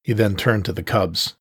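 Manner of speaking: ordinary delivery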